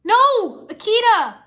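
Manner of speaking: high, loud cry of protest; anguished cry. A narrator's reading. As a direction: cry out as a friend is struck. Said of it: "Lloyd cried".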